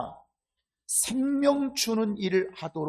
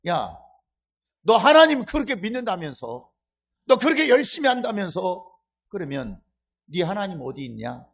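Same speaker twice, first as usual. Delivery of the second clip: 높고 크게 몰아세우다 낮춰 되묻기; 빈정대는 사람 흉내로 따져 묻기; 조롱과 비난; 4 semitones higher, 8 dB louder than usual. Acted. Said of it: "조롱과 비난이 지금 들어있어요". (믿는 이를 비웃듯 다그치는 말투)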